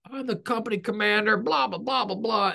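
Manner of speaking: half-hearted imitation of barking orders; retold yelling. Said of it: "I start yelling at him".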